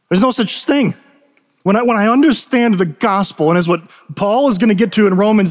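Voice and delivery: impassioned preaching. Real, not acted. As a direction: preach it with conviction, leaning on key words. Preaches with rising force.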